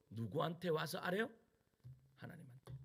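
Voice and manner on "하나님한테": whispered answer. Real, not acted. Answering own question softly.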